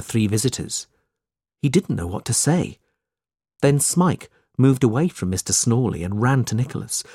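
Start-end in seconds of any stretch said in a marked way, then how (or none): none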